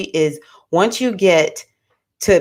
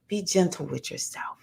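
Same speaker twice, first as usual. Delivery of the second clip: hushed, calming elder imitation; Baba G; 11 dB quieter than usual. Godfather gently reminding his godchildren, softly calming. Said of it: "He had this real super calming voice".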